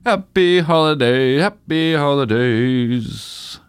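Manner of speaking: slow, sing-song holiday wish; drawn-out singsong. Real, not acted. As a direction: stretch it out cheerfully. Drawn-out festive sign-off.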